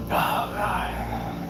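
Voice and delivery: whispered dismay; mock-dread whisper, very soft; dreading aside. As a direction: let it out under the breath. Acts out dread to himself.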